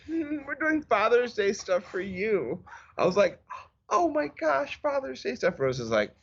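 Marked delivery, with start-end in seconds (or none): 0.1-2.6 put-on higher, slow little girl voice; sweetly coy kid daughter. 3.9-5.6 mock delighted gasp. Dad's excited reaction.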